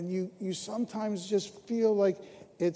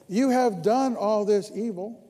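louder, proclaimed; preached scripture; 5 semitones higher, 7 dB louder than usual. Acted. Pastor reading Samuel to the church, proclaimed louder. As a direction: proclaim the verse to the room. Reads Samuel's words in the sermon.

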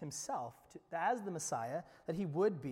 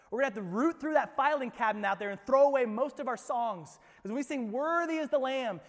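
loud, higher preaching build; full preaching; 7 semitones higher, 9 dB louder than usual. Real, not acted. Pastor warning the congregation about idolatry, preaching loud. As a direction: drive the point home to the pews.

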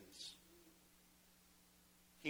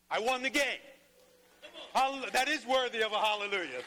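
higher and louder, a victorious cry; triumphant; 9 semitones higher, 6 dB louder than usual. Real, not acted. Pastor reliving his winning hit for the congregation, jubilant. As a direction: shout the win to the church.

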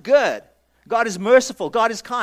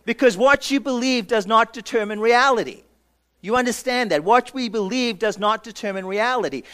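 normal talking voice

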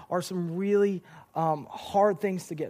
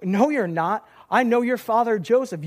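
raised-voice heckle, lightly acted; mild heckling yell; 4 semitones higher, 6 dB louder than usual. Someone in the crowd challenging Jesus, mild mock yell. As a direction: imitate a heckler calling out from a crowd. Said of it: "someone in the crowd yells out".

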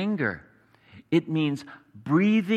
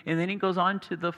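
ordinary delivery

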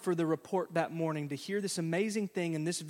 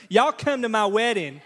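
folksy loud invite; 4 semitones higher, 8 dB louder than usual. Retold or imitated. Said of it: "She's going".

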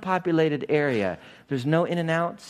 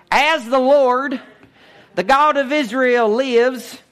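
country bumpkin Elijah; 8 semitones higher, 5 dB louder, slower than usual. Acted. Elijah from the sticks, warning the king, hammed-up country voice. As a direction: boom it out like a hillbilly preacher. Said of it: "I'm being a guy from New Kuyama".

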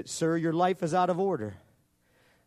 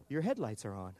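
computer voice; 5 semitones lower, 7 dB quieter than usual. Retold or imitated. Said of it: "the computer voice go".

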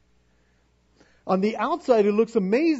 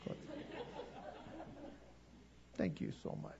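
sweet dismissive mother; 5 semitones lower, 21 dB quieter, faster than usual. Retold or imitated. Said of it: "she's probably going".

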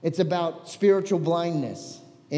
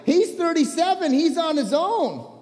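passionate parents' retort; 8 semitones higher, 4 dB louder than usual. Acted. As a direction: toss the son aside with a shrug in your voice. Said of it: "I was passionate".

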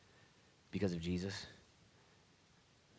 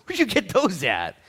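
higher, louder exasperated Jesus, slowing down; frustrated Jesus; 11 semitones higher, 11 dB louder than usual. Retold or imitated. Pastor voicing Jesus exasperated at the two swords, higher and louder. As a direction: play Jesus fed up with the disciples' swords. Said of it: "it was a frustrated tone".

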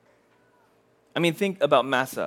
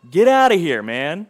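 incredulous outburst; 2 semitones higher, 7 dB louder than usual. Acted. Preacher imagines being called back by God, incredulous outburst. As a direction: blurt it out loud, then drop back on the last word. Imagined reaction to God's call.

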